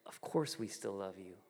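soft, low voice of forgiving parents; tender reassurance. Retold or imitated. Parents answering son's fearful confession, softly reassuring. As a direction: imitate a parent gently soothing a child.

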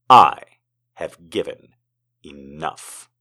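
deliberate, dramatic poem reading ending hushed; poetry reading. Acted. Reads a poem aloud.

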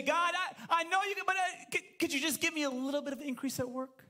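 mock timid asker; timid small prayer. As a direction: beg God meekly, stammering. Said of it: "When did you say".

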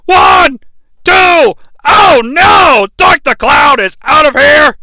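high, loud play-by-play yell; excited shouting. Acted. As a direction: call the finish at the top of your lungs. Calling a wrestling match finish.

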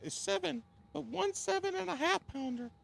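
high strained falsetto voice; cracking high pretend voice. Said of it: "my voice is cracking up".

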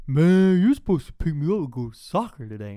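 loud, high-pitched protest; hammed-up loud teenage complaint; complaining little brother. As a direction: imitate an indignant kid brother's outburst. Brother's whining complaint voiced in story.

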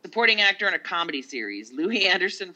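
loud awards announcer read. Pretend. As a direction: announce the category. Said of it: "I'm trying to sound like the announcer chick".

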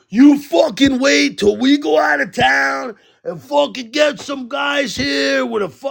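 imitating a livid neighbor's high shout. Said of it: "he was so livid".

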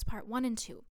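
usual speaking voice

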